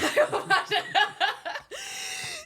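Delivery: high, loud cackling laugh; long helpless high laugh; burst of laughter. Real, not acted. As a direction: crack up loudly at a joke. Reacting to crude joke.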